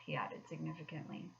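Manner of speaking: ordinary delivery, nothing marked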